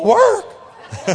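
high-pitched startled squawk of "Work?"; comic shriek at a dreaded word; Maynard Krebs. Acted. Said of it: "every time you'd say, "Work," he'd go".

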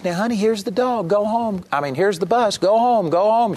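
put-on high, pleading old woman coaxing a dog; elderly woman urging her dog onto home; urging old lady. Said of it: "and she says".

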